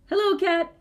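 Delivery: chirpy little duck saying hello; cheerful duck voice. Acted. Duck greets cat.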